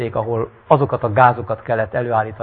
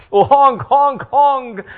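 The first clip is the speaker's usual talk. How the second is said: laughing with joy; 13 semitones higher, 4 dB louder, much slower than usual. Acted. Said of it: "kacagott a süket".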